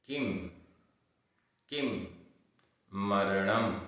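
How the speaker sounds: slow word by word, each repeated after a pause; careful recitation with long gaps between words; pronunciation drill. Shown as a demonstration. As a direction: model each Sanskrit word slowly and distinctly. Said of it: "उच्चारण".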